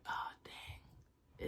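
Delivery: barely voiced, sinking whisper; whispered dismay. Student held back from lunch, whispered dismay. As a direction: whisper it as your fate sinks in.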